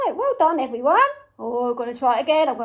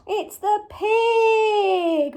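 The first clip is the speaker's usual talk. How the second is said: higher, slow stretched cheer; drawn-out reveal; 5 semitones higher, much slower than usual. Pretend. Presenter telling children the answer, drawn-out and excited. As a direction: stretch out the animal's name in delight.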